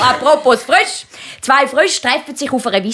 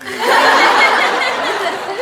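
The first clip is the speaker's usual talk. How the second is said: high laugh; real laughter; laughing at the penguin joke; 12 semitones higher than usual. Real, not acted.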